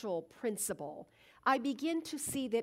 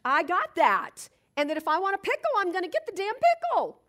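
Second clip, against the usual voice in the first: louder and higher, set on winning; determined declaration; 7 semitones higher, 6 dB louder, faster than usual. Acted. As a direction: declare you'll get that pickle no matter what. Resolving to beat the jar.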